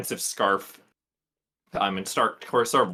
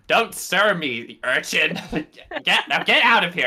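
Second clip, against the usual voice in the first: loud, raised-pitch outburst at an urchin; hammed-up angry dismissal; indignant scolding; 6 semitones higher, 5 dB louder than usual. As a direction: shoo the kid away, getting louder. Snapping at a street urchin.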